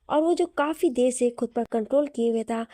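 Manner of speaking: usual speaking voice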